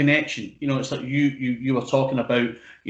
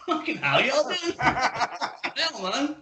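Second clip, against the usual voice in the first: high-pitched mock American, laughing through it; hammed-up American drawl breaking into laughter; exaggerated American impression, very high and giggly; 12 semitones higher, much slower than usual. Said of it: "he's talking like [name]".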